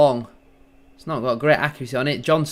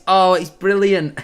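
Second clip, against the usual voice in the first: gleeful delight; 4 semitones higher, 6 dB louder, slower than usual. Real, not acted. Rival player seen crying.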